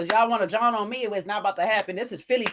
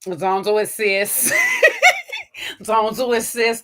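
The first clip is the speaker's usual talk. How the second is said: slow, drawn-out, laughing "Zonzo assist"; laughing quote; 3 dB louder, slower than usual. Retold or imitated. Sahara dismissing the others, slow and laughing. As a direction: stretch it out, breaking up. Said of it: "Sahara was like".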